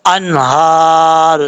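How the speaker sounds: slow انہار with a heavy, full ہا. Shown as a demonstration. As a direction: stretch انہار and give the ہا full weight. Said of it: "یہ ہا بھاری sound کے ساتھ پڑھا جائے گا".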